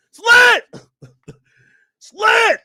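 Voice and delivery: loud high hype shout. Acted. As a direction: yell it like a hype man. Hype chant louder.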